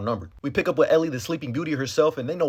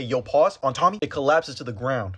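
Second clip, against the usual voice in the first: ordinary delivery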